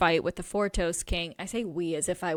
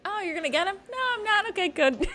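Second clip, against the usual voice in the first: high-pitched playful back-and-forth; mock self-talk; 13 semitones higher, faster than usual. Host plays the queen talking to herself, high mock dialogue. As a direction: answer yourself in a high, jokey voice. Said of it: "have a talkie with myself".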